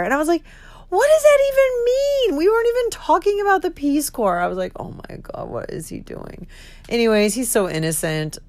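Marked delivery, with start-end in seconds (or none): 0.9-4.4 very high, incredulous mock outburst; exasperated protest. Retold exasperated protest to dad. 4.8-6.5 whispered, low-energy inner panic; horrified aside. Retold or imitated. Retold horrified thought.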